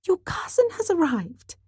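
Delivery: very high, fluttering mother's voice; thrilled, high-pitched news-breaking; excited announcement. Acted. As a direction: announce the visitor in a flap.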